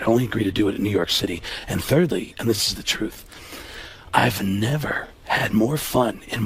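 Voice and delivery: low, measured Baldwin voice; Alec Baldwin impression. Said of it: "he said, he said".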